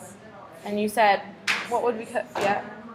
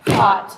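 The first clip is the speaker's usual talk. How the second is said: Hot punched out louder as one beat; pounding syllable; 8 dB louder than usual. Shown as a demonstration. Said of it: "let's pound and sound hotbed".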